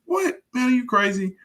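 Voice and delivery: high-pitched acted disbelief; squeaky "what?", easing down into disbelief; mock incredulity. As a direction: react as if the offer is absurd. Acted incredulous reply to picture offer.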